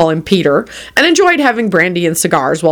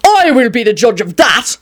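self-important, raised-pitch pronouncement; officious; 5 semitones higher than usual. A narrator's reading. Doctor overruling his reluctant patient, officious announcement. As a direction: announce it like a pompous authority. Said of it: "announced the doctor officiously".

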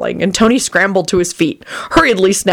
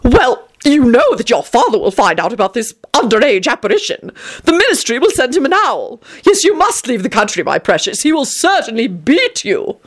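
rapturous; 6 semitones higher than usual. Acted. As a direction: gush it out in raptures. Vampire Tony's voice.

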